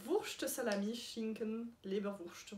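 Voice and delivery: slow, careful German accent; attempted German accent. Real, not acted. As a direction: read it out slowly in your best German accent. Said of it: "avec mon super accent allemand".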